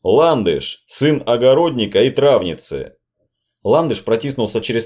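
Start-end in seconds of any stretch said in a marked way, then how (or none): none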